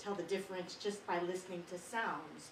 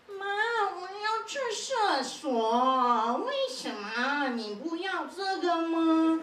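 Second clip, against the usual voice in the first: high, loud, slow whining Mandarin; whiny Mandarin; 7 semitones higher, 6 dB louder, much slower than usual. Retold or imitated. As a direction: whine it like a sulking kid. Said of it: "it sounds like they're whining".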